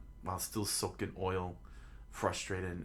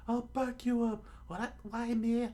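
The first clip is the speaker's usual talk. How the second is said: very high falsetto protest; high put-on squabbling; reluctant fighter. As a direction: balk in a squeaky cartoon voice. Quarrel over who attacks.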